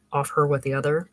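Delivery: plain reading voice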